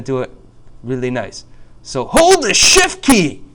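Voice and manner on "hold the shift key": high, drawn-out mock yell; playful over-the-top holler; comic shout. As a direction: belt out the instruction slowly for laughs. Comic shouted instruction in tutorial.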